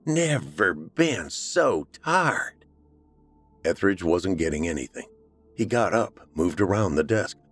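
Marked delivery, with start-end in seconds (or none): 0.0-2.6 higher, drawn-out country woman voice; Missus Warren voice. A narrator's reading. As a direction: play the weary rural mother. Missus Warren, weary dead woman.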